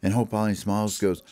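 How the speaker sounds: ordinary delivery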